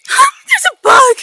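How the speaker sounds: high, loud slow shriek at a bug; startled shriek. Real, not acted.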